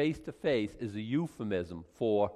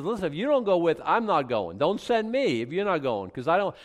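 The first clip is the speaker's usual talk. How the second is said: insistent Moses; 5 semitones higher, 5 dB louder than usual. Retold or imitated. Moses pleading with God.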